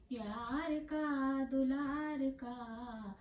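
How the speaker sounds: lilting, half-sung verse with drawn-out endings; poem recitation. Acted. Poet reciting about beloved grandmothers, melodic recitation. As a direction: chant it tunefully, stretching the vowels.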